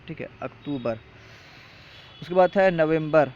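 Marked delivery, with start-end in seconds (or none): 0.4-0.9 اکتوبر said "aktoobar", softly, without an m; soft, corrected اکتوبر with long oo; no M sound. Shown as a demonstration. Teacher repeating the corrected month name, no M sound. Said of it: "میم نہیں ہے".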